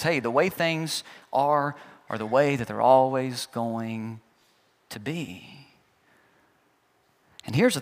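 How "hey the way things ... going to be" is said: lower, soft, slow resigned sigh of words; defeated coward. Retold or imitated. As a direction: give up before starting, mildly. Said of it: "sounds like a coward who's already given up".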